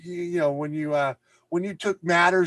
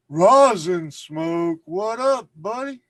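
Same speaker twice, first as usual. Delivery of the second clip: high, loud, slow call to a dog; calling to a pet; 5 semitones higher, 8 dB louder, slower than usual. Real, not acted. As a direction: sing out a greeting to the pup.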